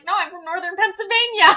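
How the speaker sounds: laughing denial. Retold or imitated. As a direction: laugh through the correction. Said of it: "I was like".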